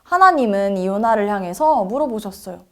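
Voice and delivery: ordinary delivery, nothing marked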